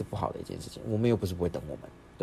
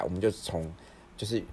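ordinary talk

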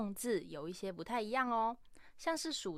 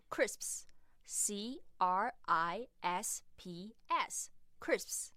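slow spelling-out; much slower than usual. Shown as a demonstration. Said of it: "英式英文的洋芋片是crisps".

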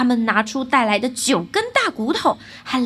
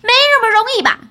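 high, loud, cheeky challenge; defiant retort; 9 semitones higher, 9 dB louder, faster than usual. A narrator's reading. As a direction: fling it back unafraid. Boy defies the fiend. (高声不服气地顶嘴)

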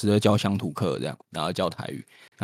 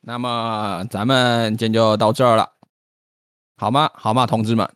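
Beijing accent; 3 dB louder than usual. Shown as a demonstration. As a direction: wrap up like a Beijing radio host. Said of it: "那咱们用北京腔".

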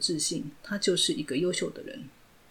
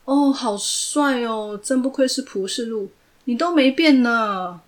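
mock praise; 5 semitones higher, 6 dB louder, slower than usual. Villain mockingly claps.

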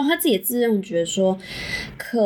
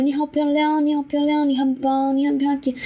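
put-on higher, lightly accented repeated compliments; imitating Japanese lover; 6 semitones higher than usual. Friend retelling a Japanese lover's bedroom compliments, higher mock accent. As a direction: mimic a foreigner's halting Chinese praise. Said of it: "一直讲说".

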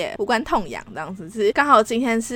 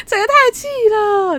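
laughing frustration; 12 semitones higher, 5 dB louder than usual. Real, not acted.

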